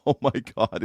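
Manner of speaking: usual speaking voice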